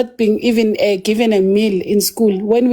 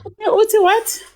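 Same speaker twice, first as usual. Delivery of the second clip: high, disbelieving question; incredulous; 11 semitones higher, slower than usual. Real, not acted. Hearing the host's quote, asks in high disbelief. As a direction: jump way up in disbelief.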